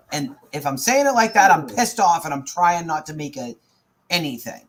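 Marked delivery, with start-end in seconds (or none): none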